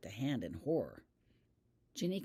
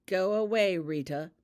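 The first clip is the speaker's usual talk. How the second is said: a cold, sharp order, louder and higher; coldly; 3 semitones higher, 8 dB louder than usual. A narrator's reading. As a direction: snap it at her to go, icy and loud. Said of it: "he said coldly".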